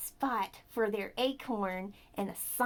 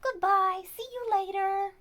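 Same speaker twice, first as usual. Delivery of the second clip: honking voice; 8 semitones higher, 5 dB louder than usual. Pretend. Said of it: "you can probably honk".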